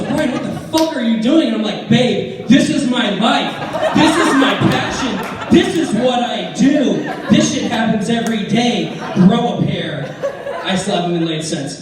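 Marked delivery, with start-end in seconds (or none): 0.0-1.5 higher, louder, outraged yell with a laugh; a mock scandalised scream; shocked fiancée yelling. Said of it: "She goes". 1.9-10.1 higher and louder, a gleeful laughing shout; loud triumphant mock of her speech; mocking her words, shouting. Parroting her lines back, shouting.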